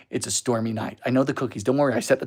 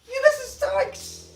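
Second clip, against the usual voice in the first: laughing; 3 dB quieter, slower than usual. Real, not acted. Laughing through pain.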